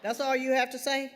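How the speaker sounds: mother bristling at her daughter's flat reply; indignant mother. A narrator's reading. Mother on the phone, offended her daughter says so little, indignant.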